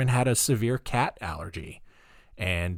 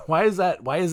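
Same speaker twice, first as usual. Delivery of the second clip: laughing; 5 semitones higher, faster than usual. Real, not acted. Laughing at own voice.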